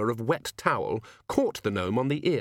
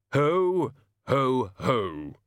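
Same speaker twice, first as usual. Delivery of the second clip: practised Santa-style laugh; 3 semitones higher, 3 dB louder, much slower than usual. Pretend. Death practising the Hogfather laugh for his servant, stilted. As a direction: boom out an unconvincing festive laugh.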